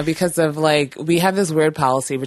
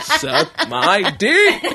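slow, laughing retort; laughing defiance; 9 semitones higher, 4 dB louder, much slower than usual. Retold or imitated. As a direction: draw it out through a laugh.

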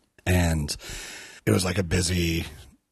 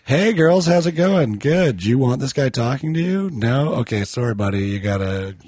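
bright, high, loud bartender voice; loud cheery callout; 6 semitones higher, 5 dB louder than usual. Retold or imitated. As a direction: call it out brightly across the bar. Bartender steps in on creep.

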